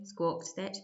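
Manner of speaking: ordinary delivery, nothing marked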